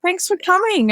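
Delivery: chirpy put-on hostess voice hiding the hurt; pretend cheerful. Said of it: "you just pretend".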